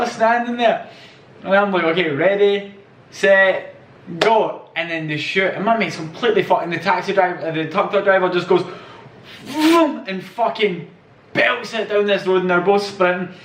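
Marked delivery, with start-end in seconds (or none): 1.8-4.7 calling out the race start; starter's call. Retold or imitated. Starting the race between his mate and the driver, called out. 9.4-10.0 high vroom sound. Retold or imitated. Describing the driver sprinting off like a car, imitated vroom.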